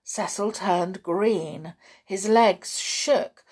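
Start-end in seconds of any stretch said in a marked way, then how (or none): none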